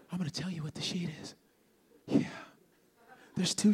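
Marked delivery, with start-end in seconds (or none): 0.1-1.3 fast conspiratorial whisper. Real, not acted. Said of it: "I'm about to tell you".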